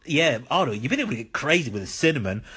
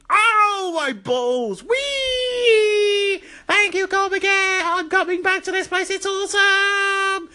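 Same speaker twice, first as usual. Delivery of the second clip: very high squealing yelp of joy; hammed-up pained glee; slower than usual. Retro Dude kicked by Arnold, high squealing mock pain. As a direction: shriek like a cartoon victim loving it.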